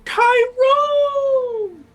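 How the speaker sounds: long, high shouted greeting; big drawn-out hail; delighted call. Acted. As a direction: holler the name, stretching the end. Character greets goblin by name.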